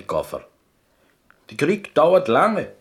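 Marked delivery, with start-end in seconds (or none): 1.5-2.7 his voice carries too far as he tells it; raised storyteller voice, forgetting to keep it down; too loud. Acted.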